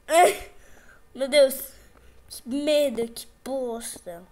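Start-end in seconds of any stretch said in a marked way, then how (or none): none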